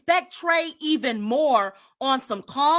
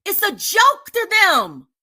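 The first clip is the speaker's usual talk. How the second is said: loud, higher, bitter outburst; angry shout; 5 semitones higher, 5 dB louder than usual. Real, not acted. Ranting to viewers about mocking mothers, angry shout. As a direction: throw it out in disgust.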